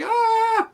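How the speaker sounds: high, drawn-out "ah"; mock wail held on a high note; imitated cry. Acting out someone's reaction for a friend, high mock cry. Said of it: "He's like".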